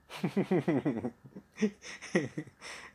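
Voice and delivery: long rippling giggle; real laughter. Real, not acted. Storyteller amused by the courier joke, laughing. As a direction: laugh at your own joke.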